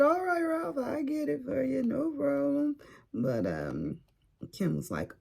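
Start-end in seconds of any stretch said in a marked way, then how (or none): 0.0-2.8 high, raised-voice retold reply; calling back. Mother-in-law answering her yelling son-in-law, high calling voice. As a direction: call it back high, starting loud.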